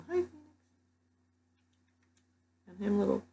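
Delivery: high coo to the pet. Real, not acted. Talking to Phoenix, a pet.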